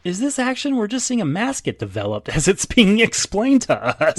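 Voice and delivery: high-pitched sceptical question; incredulous challenge. Real, not acted. Host disputing that mask-making counts as action, incredulous. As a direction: question it in rising disbelief.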